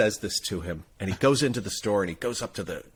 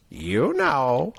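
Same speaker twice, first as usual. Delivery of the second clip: drawn-out, higher, rising parrot voice; parrot punchline; 4 semitones higher than usual. Acted. Parrot slyly reminding the guy, parrot voice. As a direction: land it as a knowing parrot. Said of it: "And the guy looks at him and he goes".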